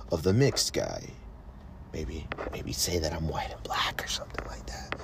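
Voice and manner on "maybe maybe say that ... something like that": whispered aside, much softer. Real, not acted. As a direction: whisper it like a secret. Confiding aside to the listener.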